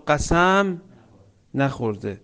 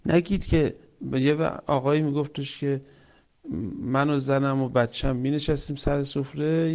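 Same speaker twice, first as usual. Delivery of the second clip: ordinary talk, nothing marked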